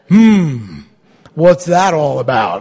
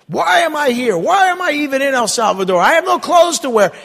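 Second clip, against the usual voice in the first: exasperated; 9 semitones higher than usual. Retold or imitated. Reliving frustration on trip.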